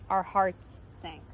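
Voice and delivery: plain reading voice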